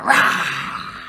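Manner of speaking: low whispered roar; a big cat's growl, low and breathy; tiger roar. Acted. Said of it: "out jumped the tiger".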